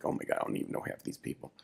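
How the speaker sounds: fast hushed whisper; fast breathless whisper; whispered aside. Retold or imitated. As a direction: mutter it under your breath, quickly. Whispered aside in retold scene.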